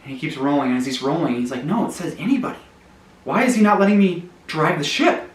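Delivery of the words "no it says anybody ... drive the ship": higher, indignant outburst; getting mad. Acted. Donut rolling away, fuming at the captain. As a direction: protest with rising frustration. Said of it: "he starts to get so mad".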